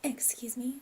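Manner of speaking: breathy unvoiced whisper, rising at the end; whispered excuse me. Real, not acted. Drinking on camera, an unvoiced whispered excuse me. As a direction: hush it while drinking on camera.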